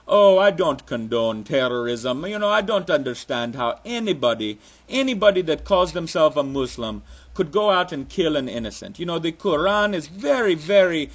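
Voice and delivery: put-on muddled Russian-Jamaican-Transylvanian accent.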